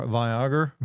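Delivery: "Viagra" said vy-AH-ger; hard-R version. Shown as a demonstration. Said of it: "Is that what you're worried about?".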